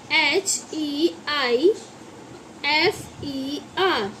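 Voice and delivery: slow letter-by-letter spelling; measured classroom spelling. Shown as a demonstration. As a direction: spell it out one drawn letter at a time. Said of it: "इसका means है heifer".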